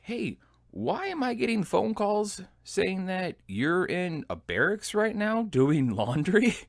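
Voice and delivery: high, slow, drawn-out questioning; questioning platoon sergeant. Retold or imitated. Platoon sergeant phones soldier about laundry, high-pitched questioning. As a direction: act out a baffled superior demanding an explanation.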